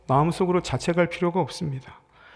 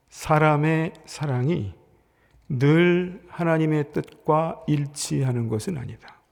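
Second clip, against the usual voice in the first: each word laid down slowly; slow, weighty; slower than usual. Shown as a demonstration. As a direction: spell it out slowly so it sticks. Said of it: "이거 기억하세요".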